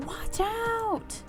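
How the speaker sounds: high-pitched drawn cry of alarm; acted panicky call, not full volume; alarmed warning. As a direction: warn someone in sudden danger. Warns woman of speeding car.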